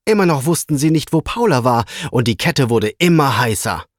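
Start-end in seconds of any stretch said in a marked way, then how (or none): none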